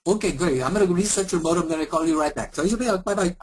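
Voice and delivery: fast, high put-on Indian accent; mock agent hurrying off the call in an Indian accent; hammed-up Indian accent, fast; bad Indian accent, rushed. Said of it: "really bad Indian and Eastern European accent".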